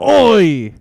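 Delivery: high, shouted British accent veering Australian; bad British accent. Shown as a demonstration. Co-host answers a request with a loud high botched British accent. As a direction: holler a hopelessly wrong British accent. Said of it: "a straight up British accent".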